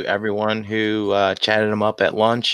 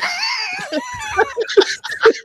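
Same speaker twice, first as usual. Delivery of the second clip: shrieking laugh. Real, not acted. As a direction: howl with laughter. Big laugh in story.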